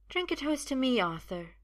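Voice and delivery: high-pitched woman's voice; high, sweetly insistent urging; coaxing woman. Acted. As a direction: coax him in a high, lilting voice. Sophia coaxes Arthur to drink.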